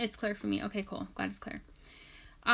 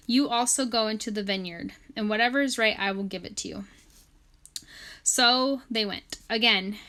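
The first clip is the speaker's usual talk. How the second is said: scripture reading; 4 dB louder than usual. Acted. Reads scripture aloud.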